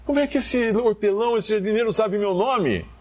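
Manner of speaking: high-pitched, incredulous question; puzzled Maria. Acted. Maria wondering how the gardener knows her name, puzzled.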